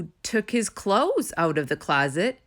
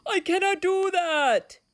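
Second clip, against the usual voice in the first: pitched-up upset protest; high distressed refusal; 11 semitones higher than usual. A narrator's reading. Toad refuses to chase the list, high upset protest. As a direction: insist in distress. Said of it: "shouted Toad".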